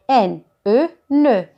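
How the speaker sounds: usual speaking voice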